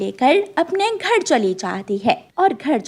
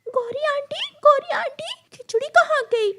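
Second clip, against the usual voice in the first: very high chick calling out; calling child. Acted. Chicks calling the crow auntie, high call.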